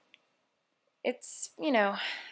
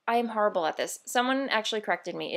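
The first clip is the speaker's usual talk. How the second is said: ordinary talk, nothing marked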